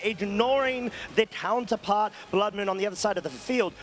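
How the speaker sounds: ordinary talk, nothing marked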